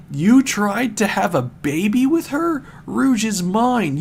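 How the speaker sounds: lightly hammed-up jealous boyfriend; mock jealous rage. Knuckles confronts S B O over Rouge, mock fury. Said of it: "sent Knuckles into the next level of his anger".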